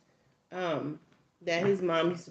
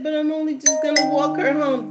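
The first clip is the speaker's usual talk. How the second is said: put-on boy's excuse; 8 semitones higher, 9 dB louder than usual. Boy explains himself.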